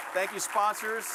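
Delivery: normal talking voice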